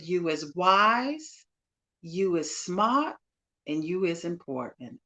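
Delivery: slow Southern drawl with rising phrases; Southern broken voice. Retold or imitated. Guest quoting a film's maid to a young girl, Southern broken voice. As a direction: draw out each affirmation in broken Southern English. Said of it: "in her Southern broken voice".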